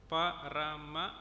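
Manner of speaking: "Parama" drawn out slowly, rising at the end; slow syllable count. Shown as a demonstration. Teacher demonstrating syllable beats in Parama, slowly. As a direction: spell out "Parama" beat by beat. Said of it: "Suppose I were to say".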